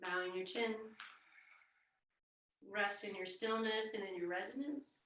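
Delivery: soft, quiet voice guiding a rest in stillness; hushed meditation guidance. Acted. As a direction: lull them into stillness.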